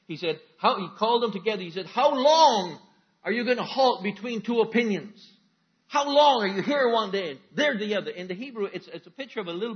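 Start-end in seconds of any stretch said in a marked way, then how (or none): 1.9-8.1 Elijah calling out loud and high to the crowd; loud, high prophet's challenge. Acted.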